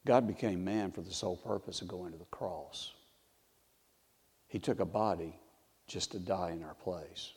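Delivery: soft, low, solemn reflection; hushed solemnity. Real, not acted. Solemn communion reflection on the cross.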